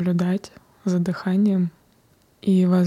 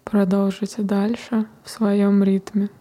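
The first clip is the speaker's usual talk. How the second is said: guided meditation. Acted. Closes the meditation.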